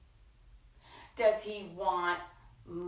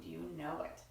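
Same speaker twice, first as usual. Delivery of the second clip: plain reading voice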